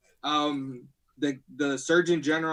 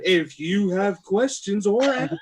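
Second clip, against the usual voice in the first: slow, higher quiz-host plug; game show host; 4 semitones higher, 4 dB louder than usual. Pretend. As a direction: hype it up like a TV host. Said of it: "Give it in the game show voice".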